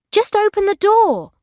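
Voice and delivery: high-pitched exasperated order; insistent demand. A narrator's reading. Bridget shut outside Daniel's flat, insistent demand. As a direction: demand it, pitch leaping up impatiently.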